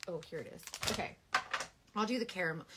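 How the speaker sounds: normal talking voice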